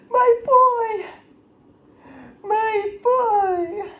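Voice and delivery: high, loud, drawn-out tearful wail; wailing grief. Acted. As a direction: wail for your dead child, hammed-up. Acts out mother wailing for killed son.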